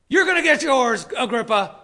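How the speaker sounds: loud, high imagined threat; hammed-up threatening yell; mock vengeful threat. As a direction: play a vindictive shout, then drop it. Mock threat Paul never said.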